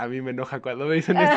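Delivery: amused, laughing protest; laughing admission. Real, not acted. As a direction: confess it while cracking up. Laughing admission of getting angry.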